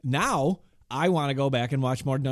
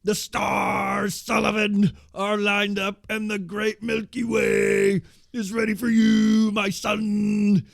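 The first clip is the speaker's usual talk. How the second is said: booming high shout with long drawn-out words; hammed-up screaming cult-leader promo; screaming Master voice; 8 semitones higher, 5 dB louder, slower than usual. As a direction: wail it out as loud as you can, stretching the syllables. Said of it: "he's doing this voice".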